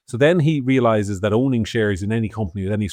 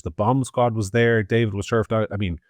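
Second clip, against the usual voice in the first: ordinary delivery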